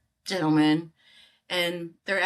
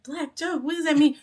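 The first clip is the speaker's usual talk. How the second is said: baffled inner voice; 10 semitones higher, 3 dB louder than usual. Retold or imitated.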